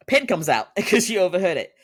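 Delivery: breaks into a laugh mid-sentence; loud, high, laughing narration; laughing while telling. Real, not acted. Laughing while retelling scene.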